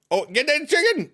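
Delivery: very high urgent yelp; high squawked command; frantic game yell. Real, not acted. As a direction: burst out mid-song at the escaping chicken. Breaks off song to yell at game.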